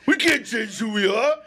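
high loud Rocky yell; shouting Rocky. Acted. Rocky yelling he's a fighter at Adrian, loud. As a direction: bellow it as Rocky. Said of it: "when you go loud".

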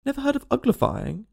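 high-pitched astonished question; surprised exclamation. A narrator's reading. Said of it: "it exclaimed".

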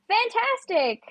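high mock-cheerful sarcasm; high sarcastic cheer at bad news. Car reservation missing, sarcastic.

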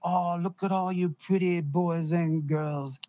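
put-on slow, leering drunk threat; slow, slurred taunt of a big drunk; menacing drunk. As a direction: draw out each word like a menacing drunk. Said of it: "he's literally doing this. He's going".

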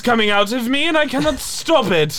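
mock panic in a stuck British accent. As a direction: pretend he can't stop talking British. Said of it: "I am stuck in this accent".

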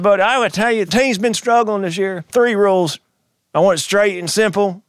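hammed-up big man's voice, pitched high; gruff board chair. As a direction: imitate a gruff official handing down a ruling. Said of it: "this big, gruff, six-foot-six gentleman".

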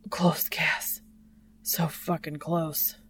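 unvoiced, strained whisper; breathless. Dean to Cass on the edge, breathless whisper. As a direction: gasp it out on the edge.

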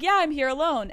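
high, cocky reply; retold asshole attitude. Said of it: "Matt's like an asshole".

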